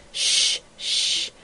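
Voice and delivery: hushed, breathless shushing; frightened whisper. A narrator's reading. Girl hushing the magpie beside her sleeping husband, whispering. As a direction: shush him urgently under your breath. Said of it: "replied the girl in a whisper".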